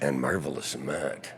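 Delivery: slow, low announcer tone; movie trailer voice. Shown as a demonstration. Guest completes the nickname intro, movie trailer voice. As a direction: draw it out deep, like a film trailer. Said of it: "And, and I'll do the thing".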